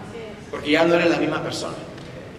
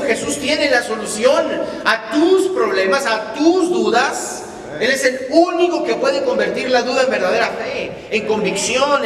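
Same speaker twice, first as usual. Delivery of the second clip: preaching at full force; 7 semitones higher, 3 dB louder than usual. Real, not acted. Preacher proclaiming to the church that Jesus calms storms. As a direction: drive the promise home to the congregation.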